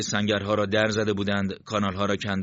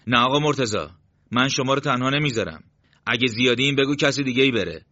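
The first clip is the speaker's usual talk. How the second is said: raised, higher voice of refusal; louder, higher protest to the leader; protesting comrade; 5 semitones higher, 6 dB louder than usual. A narrator's reading. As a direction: object loyally, voice lifted and louder.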